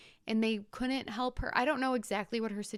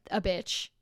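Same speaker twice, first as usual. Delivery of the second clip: ordinary talk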